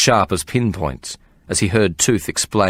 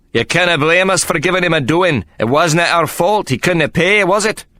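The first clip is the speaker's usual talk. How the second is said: put-on higher and louder in a Scottish accent; Scottish fighter playing to the crowd; Scottish appeal; 9 semitones higher, 4 dB louder than usual. A narrator's reading.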